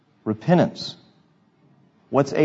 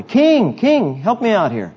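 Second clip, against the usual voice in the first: calling out; 8 semitones higher, 3 dB louder, slower than usual. Acted. Prophet calling out to king.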